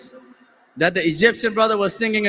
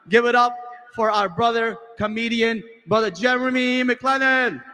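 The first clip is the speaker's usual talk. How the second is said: raised hype voice, slow stretched name; announcer introduction; 3 semitones higher than usual. Real, not acted. Host brings on the next comedian, announcer hype. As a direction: build to a drawn-out name.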